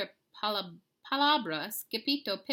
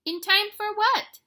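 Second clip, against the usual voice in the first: much higher, louder, slow; alarmed question; 13 dB louder, slower than usual. A narrator's reading. Kitty boy asking his friends, surprised and loud. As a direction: ask it wide-eyed and loud. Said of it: "Scapito asked".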